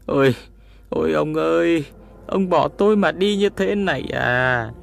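high wailing lament for the dead husband. Acted. Widow wailing over her husband's body, high lament. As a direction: cry out your grief over his body. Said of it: "miệng gào thét".

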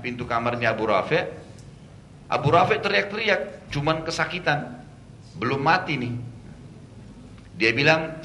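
usual speaking voice

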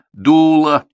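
loud, high, dug-in "Дуло"; stubborn repetition. A narrator's reading. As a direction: insist on it, refusing to budge. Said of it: "упрямо повторил Егоров".

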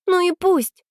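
put-on high, quick girl's voice; eager, high-pitched retort. A narrator's reading. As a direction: speak up quickly in a high young voice. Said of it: "быстро заговорила Аня".

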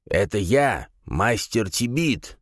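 put-on high, thin, slow old man; old man's voice. A narrator's reading. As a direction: announce yourself like a frail old man. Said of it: "раздался за дверью старческий голос".

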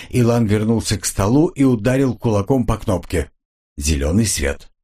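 plain reading voice

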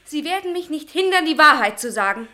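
loud, rising defiance; defiant declaration. Acted. Magda facing down the professor, loud and defiant. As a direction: refuse to be stopped.

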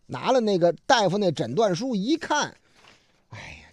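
usual speaking voice

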